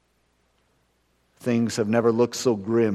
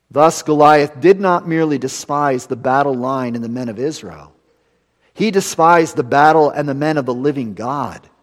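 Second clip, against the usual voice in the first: louder quoted reading; 5 semitones higher, 9 dB louder than usual. Acted. Preacher reading an author's words to the congregation, louder and emphatic.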